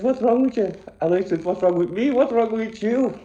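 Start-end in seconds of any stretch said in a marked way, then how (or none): 0.0-0.7 high, sharp startled question; surprised mother asking her crying son what's wrong; mum's startled cry. Retold or imitated. 1.4-3.2 put-on high, stretched child's protest; crying child throwing the question back at mum; upset retort.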